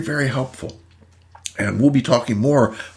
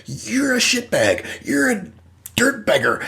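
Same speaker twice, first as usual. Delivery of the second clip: higher, half-hearted hostile jabs; mock insults; 7 semitones higher than usual. Person turning hostile on partner, mock name-calling. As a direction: fling mild mock insults. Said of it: "But when you say".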